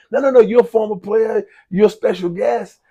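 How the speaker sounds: high, loud, excited reassurance; eager insistence. Retold or imitated. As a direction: insist warmly that he's the guest of honour. Friend insists he sits front.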